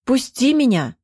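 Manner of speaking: high, desperate cry falling away. A narrator's reading. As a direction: cry out to be released. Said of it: "тоже закричала".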